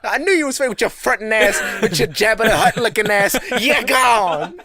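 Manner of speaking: hammed-up loud telling-off; mock outraged shout. Manager firing the faking worker, mock furious shout. Acted outburst, repeated after laughter.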